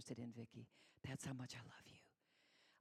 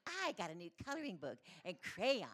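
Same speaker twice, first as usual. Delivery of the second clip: imitated little girl's boast, louder and sing-song; exaggerated, ugly-toned kid brag; bragging four-year-old; 3 semitones higher, 5 dB louder than usual. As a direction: show off to a playmate like a small child. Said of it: "she said it like that".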